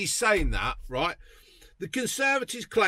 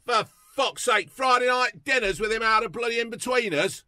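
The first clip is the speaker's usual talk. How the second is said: normal talking voice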